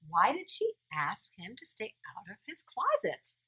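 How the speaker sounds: usual speaking voice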